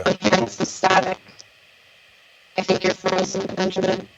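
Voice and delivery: robot voice. Real, not acted. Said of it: "you sound like a robot".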